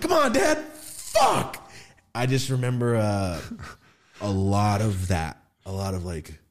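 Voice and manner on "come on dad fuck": very high, loud protest; exasperated kid. Retold or imitated. Kid protesting dad's truck stunt, high exasperated outburst. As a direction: reenact a child fed up with a parent.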